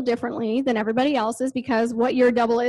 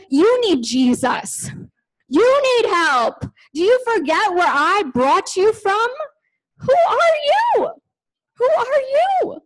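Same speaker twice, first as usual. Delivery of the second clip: high, slow, stern voice of God; God cutting her down to size, slow and firm; God rebuking her; 9 semitones higher, 3 dB louder, slower than usual. Retold or imitated. God rebuking the preacher, high and stern.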